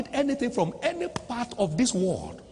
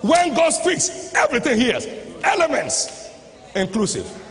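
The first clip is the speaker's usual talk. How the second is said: full-force preaching; 5 semitones higher than usual. Acted. Preacher declaring that everything hears when God speaks, full-force preaching. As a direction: proclaim it to the whole congregation, punching each phrase.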